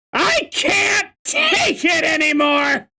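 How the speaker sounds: high squawking parrot shout; Gilbert Gottfried as Iago. Acted. Doing best Iago impression for a fed-up line, high screech. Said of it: "I can do my best Gilbert Gottfried impression".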